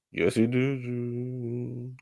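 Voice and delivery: very slow, stretched-out yes he did; slow, savoring yes he did; drawn-out sass. Acted. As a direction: drag every word out slowly. Repeats retort drawn out.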